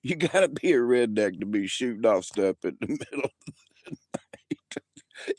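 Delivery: high squeaky words through helpless laughter; can barely get it out for laughing; laughing hard. Real, not acted. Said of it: "I'm laughing so hard".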